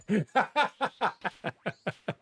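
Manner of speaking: real, high rolling laughter; long laugh. Real, not acted. Streamer jokes about going dry, long laugh.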